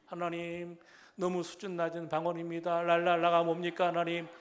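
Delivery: loud chanted complaint to God; pleading prayer. Retold or imitated. Schoolboy begging God for better tongues, loud plea. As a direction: re-enact a boy's earnest protest in prayer. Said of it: "나만의 기도였어요".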